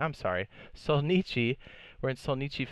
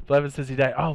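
ordinary delivery

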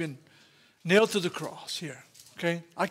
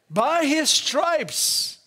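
high, very slow, stressed proclamation; 8 semitones higher, much slower than usual. Acted. Preacher proclaiming to congregation, high and slow. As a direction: ring it out, word by word.

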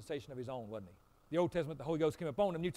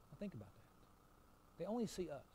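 barely voiced, dropped right down; hushed aside; 9 dB quieter, slower than usual. Real, not acted. Pastor to congregation, a hushed aside. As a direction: lean in and say it under the breath.

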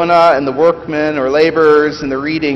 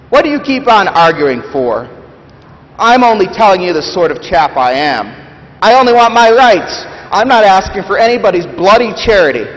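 loud, much higher, protesting; defensive ghost; 6 semitones higher, 5 dB louder than usual. Acted. Big Ghost protesting to the Spirit, loud and defensive. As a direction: bristle and insist you're not arguing.

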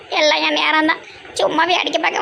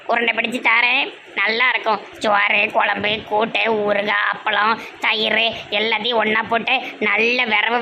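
lower, sweet coaxing mother voice; 6 semitones lower than usual. Acted. Mother coaxes her sulking son to eat, low and sweet. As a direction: tempt him with food like a doting mother.

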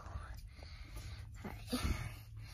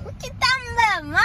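ratchet talk; 10 semitones higher, faster than usual. Pretend. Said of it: "Stop that stupid stuff".

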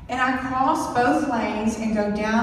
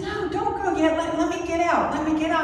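higher, urgent pleading; scared plea; 6 semitones higher, faster than usual. Retold or imitated. Trapped driver begging rescuers to stay, scared and higher. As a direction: beg them not to leave. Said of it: "I started to get scared".

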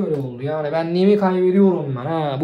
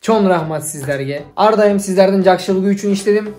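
louder, slow ceremonial address to Kyrgyz friends; formal Kyrgyz greeting; 5 dB louder than usual. Acted. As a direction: deliver it like a heartfelt public address. (Kırgızca, yüksek sesle ağır ağır resmi selam)

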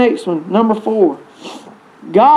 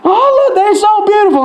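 mock gushing, much higher; gushing parent; 9 semitones higher than usual. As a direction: gush over the newborn in a high, mock-sweet voice. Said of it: "it's just like".

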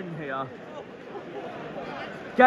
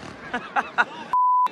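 a very high squeal of a laugh; squeaky laugh. Real, not acted. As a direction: let the laugh jump right up into falsetto. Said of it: "Your voice just sums it up".

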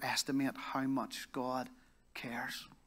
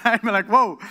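laughing; 7 semitones higher, 13 dB louder than usual. Real, not acted. Reacting to the lady's request.

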